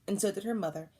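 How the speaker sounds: ordinary delivery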